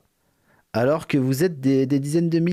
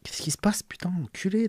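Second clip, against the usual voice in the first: whispering; 4 semitones higher, 5 dB quieter than usual. Real, not acted. Startled muttering to himself.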